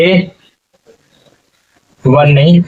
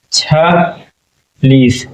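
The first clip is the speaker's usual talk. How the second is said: slowly stretched छलीस; drawn-out number; much slower than usual. Shown as a demonstration. Teacher reading छलीस to pupils, very slow. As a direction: drag out छलीस slowly, falling. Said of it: "छियालीस".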